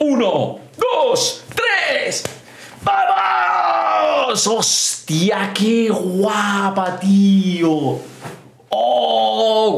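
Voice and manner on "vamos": high victory yell; triumphant shout. Real, not acted. Victory cheer after pin.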